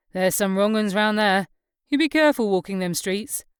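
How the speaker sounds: a hint of a wary local dad; lightly voiced cautioning father. Father warns his son's guest about rough streets, lightly voiced. Voices Ted warning Clara.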